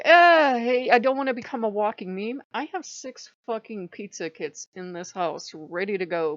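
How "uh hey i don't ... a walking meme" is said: higher, much louder "hey" protest; mock loud protest. Refusing to become a walking Italian meme, mock loud protest. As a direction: push back loudly at the idea.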